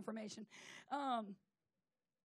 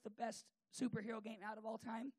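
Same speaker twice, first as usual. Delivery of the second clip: usual speaking voice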